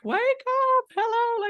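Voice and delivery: loud, very high-pitched holler; mock wake-up call. Coach wanting to wake everyone up, playful high shout. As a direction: shout as if rousing a room of sleepers. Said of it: "want to wake everyone up".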